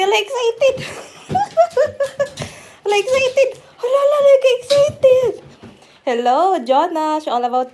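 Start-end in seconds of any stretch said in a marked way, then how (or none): 0.0-5.4 high squealing laughter; laughing squeal. Real, not acted. Laughing, hyping the dog.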